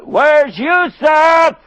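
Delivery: slow, high, drawn-out shout; drawn-out anguished yell; desperate shout. Acted. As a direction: cry out for the lost son. Father shouts his demand.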